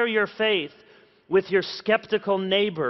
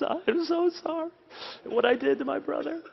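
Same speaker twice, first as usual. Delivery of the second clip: put-on sobbing child; 9 semitones higher, 4 dB quieter than usual. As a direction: wail it out like a guilty kid breaking down. Son apologizes in father's hug.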